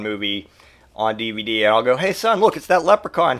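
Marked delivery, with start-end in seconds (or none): 2.0-3.4 high, playful pointing-out; mock excited teasing. Dad teasing his son in the store, mock excitement. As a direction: feign delighted discovery to needle someone.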